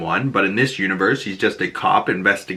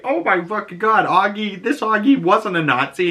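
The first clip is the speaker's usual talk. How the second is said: ordinary delivery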